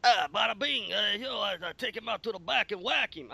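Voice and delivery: high, hammed-up New York Italian mafia accent. Friend imitating a mafia mobster on the carport, mafia accent. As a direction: play it like a tough-talking Italian mobster. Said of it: "fluent mafia".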